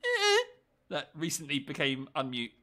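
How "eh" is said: short, high, loud animal squeak; stoat squeak. Retold or imitated. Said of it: "an impression of a mute stoat".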